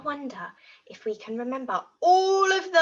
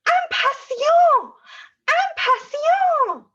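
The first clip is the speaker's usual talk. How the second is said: much higher, louder and slow; excited squeal; 10 dB louder, slower than usual. Shown as a demonstration. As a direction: burst out with excitement. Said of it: "How about the word for excited?".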